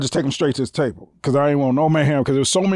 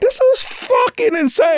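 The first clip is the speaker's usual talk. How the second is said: incredulous, very high-pitched exclamation; retold astonishment. Doorman sees a star run up with bodyguards, astonished. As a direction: squeal it in mock disbelief. Reacting to Bruce Willis's bodyguard procession.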